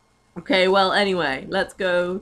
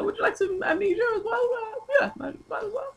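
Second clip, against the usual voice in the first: playful waiter; 3 dB quieter, faster than usual. Pretend. Playing a waiter in a bit.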